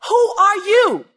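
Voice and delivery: high, loud repeated yell; shouting father. Retold or imitated. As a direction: shout it down at your son. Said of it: "He said".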